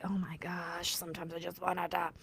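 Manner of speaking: a worn-out confession under the breath; exasperated whisper. Real, not acted. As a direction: sigh it out in a soft, voiceless whisper. Exasperated aside about her child.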